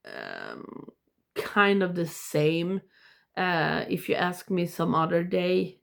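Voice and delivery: usual speaking voice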